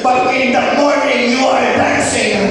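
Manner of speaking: loud, high, jubilant; exultant shouting preacher. Real, not acted. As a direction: celebrate at the top of the voice. (malipayon nga nagsinggit)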